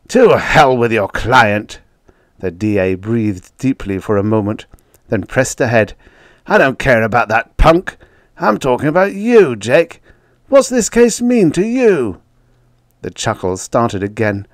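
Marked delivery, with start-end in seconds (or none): 0.1-1.8 slow, loud, emphatic outburst; exasperated, each word leaned on; angry dismissal. A narrator's reading. DA loses patience with Jake. 6.5-12.2 loud demand climbing high; exasperated, pitch soaring on the question; heated insistence. A narrator's reading. DA presses Jake for answers.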